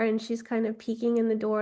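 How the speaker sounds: usual speaking voice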